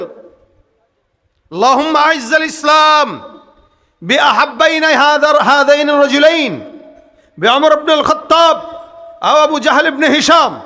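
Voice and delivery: loud, high, drawn-out Arabic prayer; fervent Arabic supplication. Retold or imitated. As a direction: cry out the prayer to the congregation. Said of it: "আল্লাহ রসুল দোয়া করেছেন".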